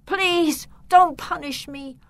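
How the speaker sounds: high, pleading voice; begging. A narrator's reading. Victor begs his uncle for forgiveness, pleading. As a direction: plead anxiously, starting high and easing slightly. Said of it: "he begged his uncle".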